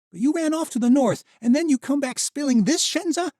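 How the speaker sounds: high, loud furious accusation; angry. Acted. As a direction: tear into him, fast at the end. Misha's anguished anger.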